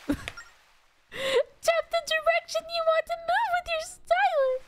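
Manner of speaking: high, slow fairy instructions; annoying fairy voice. Acted. Fairy explaining the stylus controls, annoying high voice. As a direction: instruct them slowly as a high pesky fairy. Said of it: "It has to be an annoying voice".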